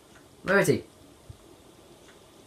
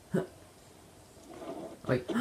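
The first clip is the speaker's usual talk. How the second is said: normal talking voice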